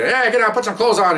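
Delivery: high, urgent mock call; retold police order. Officer retells ordering naked suspects to dress, urgent mock call.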